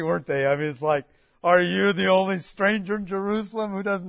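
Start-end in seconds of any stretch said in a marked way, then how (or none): 1.4-4.1 nasty retort. Retold or imitated. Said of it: "they were pretty nasty".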